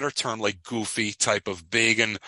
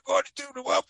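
barking drill sergeant, pitched way up; faster than usual. Retold or imitated. Drill sergeant ordering the recruit to hand over the rifle, barking high. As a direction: bark the order like a drill instructor. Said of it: "And he says to D'Onofrio".

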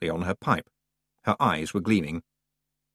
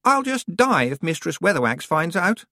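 put-on whiny young witch; 9 semitones higher than usual. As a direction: whine like a spoiled out-of-depth girl. Anagramma voice.

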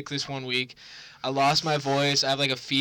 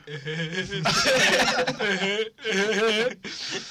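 long, higher mischievous giggle; imitated kid's giggle; 4 semitones higher, 3 dB louder than usual. Little brother coming to tickle his sick sibling, giggling. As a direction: giggle like a little kid creeping up. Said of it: "He's, like, giggling to himself".

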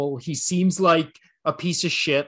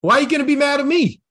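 higher, hammed-up athlete pleading innocence; joking, incredulous complaint; mock indignant protest; 7 semitones higher, 4 dB louder, faster than usual. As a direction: play-act protesting the blame, pitch climbing. Playing Favre protesting the blame.

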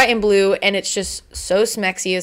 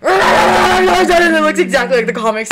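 loud, high fangirl squeal; excited squeal; 8 semitones higher, 6 dB louder than usual. Real, not acted. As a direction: squeal it out, overjoyed. Squealing over leaked toy photo.

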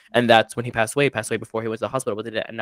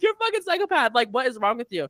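very high incredulous outburst; child yelling at mom, very high and outraged; 11 semitones higher than usual. Retold or imitated. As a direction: scream it in disbelief.

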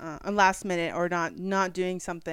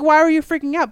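retold exasperation; 9 semitones higher, 11 dB louder than usual. Retells partner questioning her upset.

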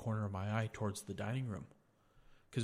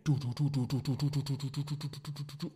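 rapid-fire "do-do-do", raised pitch; loud patter that fades as it runs off; imitated running footsteps; 4 semitones higher, 4 dB louder than usual. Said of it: "the footsteps booking it".

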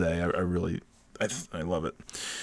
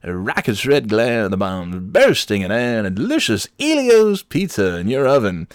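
hammed-up ballgame announcer, a touch higher; baseball announcer; 3 semitones higher than usual. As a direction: call the fireworks like a play-by-play man. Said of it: "pretending that I was a baseball announcer".

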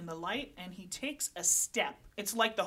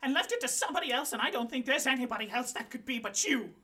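angry Lola; 6 semitones higher, 5 dB louder than usual. Acted. Lola keeps accusing.

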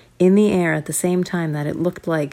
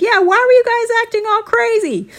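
scolding dad; 5 dB louder than usual. Acted. Dad scolding from other room.

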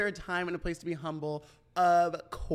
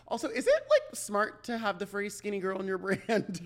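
laughing; 5 semitones higher, 3 dB louder than usual. Real, not acted. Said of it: "there's me laughing at my own joke again".

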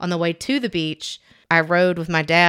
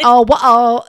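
loud, higher attempt at sounding French; mock French accent; 4 semitones higher, 9 dB louder than usual. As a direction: badly imitate a child's British-turned-French accent. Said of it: "sounding like she was French".

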